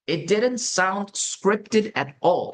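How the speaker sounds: each word laid out slowly for learners; slow model sentence. Shown as a demonstration. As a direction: read it slowly like a model answer. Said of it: "example sentence read slowly". (천천히 또박또박 읽는 예문)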